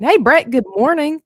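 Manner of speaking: ordinary talk, nothing marked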